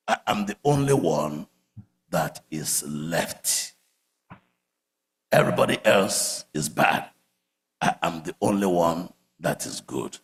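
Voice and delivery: low, quiet mock self-pity; self-pitying Elijah. Elijah sulking to God in low self-pity. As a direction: murmur it like a sulking martyr. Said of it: "Elijah had it before you".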